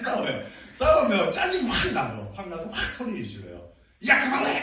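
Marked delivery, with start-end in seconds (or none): 4.1-4.6 sudden loud, high-pitched shout; angry dad yell. Retold or imitated. Pastor imitating himself scolding his fighting sons, angry yell. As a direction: act out yelling at squabbling kids. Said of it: "막 이렇게 막 소리를 지를 때가 있어요".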